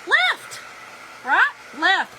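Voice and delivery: "left" shot up high and loud in disbelief; loud, high, indignant "left"; outraged emphasis. Real, not acted. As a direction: hit "left" like you still can't believe it. Mom and husband walked out.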